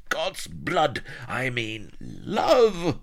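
high, loud outburst with a drawn-out final word; gnashing frustration. A narrator's reading. Pirate queen correcting the obtuse man, high and exasperated. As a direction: cry it out at the end of your tether. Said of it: "Sheba gnashed and clutched her temples".

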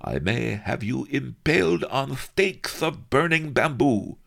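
high, languid, lisping threat; bored viceroy threat. Acted. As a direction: threaten lazily through a yawn. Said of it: "he stifled a yawn".

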